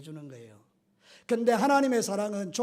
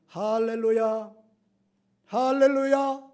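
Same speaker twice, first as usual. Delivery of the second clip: joyful hallelujah; 4 semitones higher, 8 dB louder, slower than usual. Retold or imitated. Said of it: "이러면서 올라오더라는 거예요".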